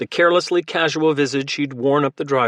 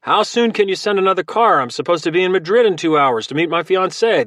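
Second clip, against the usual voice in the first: raised, higher voice calling down a phone line; shouting into the phone; 6 semitones higher, 4 dB louder than usual. A narrator's reading. Logan to rental company on phone, loud shout. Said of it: "he shouted into the phone".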